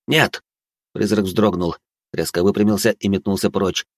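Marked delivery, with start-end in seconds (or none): 0.1-0.4 sharp short barked no; резкий окрик. A narrator's reading. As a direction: snap out a quick command. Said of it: "Я коротко рявкнул". (короткий резкий окрик)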